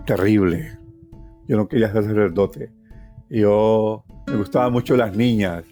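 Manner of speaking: usual speaking voice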